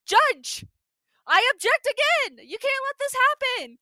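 very high, loud protest; hammed-up shouted indignation; outraged mock objection. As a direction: cry out like a hammy TV lawyer. Acting lawyer standing up to object.

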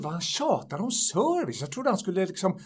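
ordinary talk

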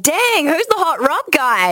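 high, drawn-out "dang"; smitten admiration. Retold or imitated. Woman recalling her first sight of a radio crush, playfully smitten. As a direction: act out being wowed by a crush. Smitten reaction to hot guy, acted.

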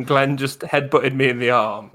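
normal talking voice

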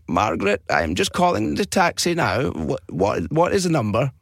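put-on slow, wavering old-man voice; old guy. Old guy calls to Margaret about the taxi number, doddery voice. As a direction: dither aloud like a confused old caller. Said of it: "pretend to be an old guy".

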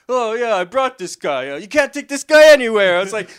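high, loud hint of a fast-talking comic; lightly voiced old-school stand-up, brushing it off; Rodney Dangerfield. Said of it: "it's really Rodney Dangerfield".

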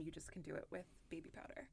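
hushed, secretive whisper. Real, not acted. Sharing a makeup secret.

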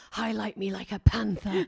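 slow, drawn-out diva command. Retold or imitated. Retelling star's answer to makeup artist, slow imitation. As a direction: put on a commanding star voice, taking it slowly. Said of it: "Okay, [name], what do you want doing?".

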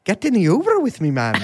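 mock-Irish cereal mascot; Lucky Charms leprechaun. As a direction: coax like a cartoon Irish leprechaun. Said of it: "do the Lucky Charms voice".

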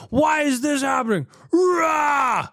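high, whining cry then roar; mock fan meltdown. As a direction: throw a tantrum as an imitated fan. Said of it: "people on Twitter being like".

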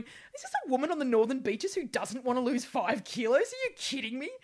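high, loud, disbelieving inner voice; mock a doctor who can't believe his ears; incredulous doctor. The doctor imagined reacting to her refusal, incredulous and loud.

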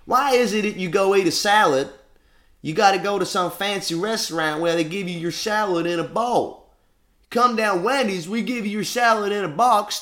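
high, loud hammed-up ad girl; mock Wendy's advert. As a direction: sell the salad like a brash TV ad. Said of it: "She went".

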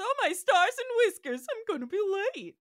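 high, loud, fast flustered rabbit. Retold or imitated. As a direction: panic like a late rabbit. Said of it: "creature appears and says".

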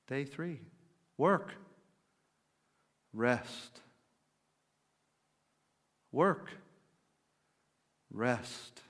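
"work" lifted, "rest" dropped low and soft; work-rest rhythm. Shown as a demonstration. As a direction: let each "rest" sink low and quiet. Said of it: "I'll walk it through with you".

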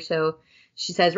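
usual speaking voice

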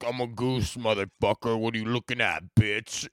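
lightly voiced mock-threatening goose; tough goose voice. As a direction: voice the goose as a street thug. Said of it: "they fucking go".

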